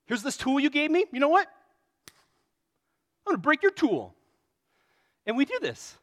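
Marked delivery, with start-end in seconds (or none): 0.0-4.1 louder, higher, spiteful taunt; playfully indignant revenge voice; mock angry outburst. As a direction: throw it back at them, hammed-up. Acting out petty revenge.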